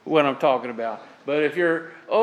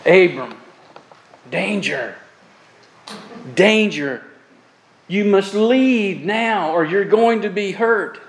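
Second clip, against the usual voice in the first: urgent warning; 4 semitones higher than usual. Acted. God's urgent warning voiced.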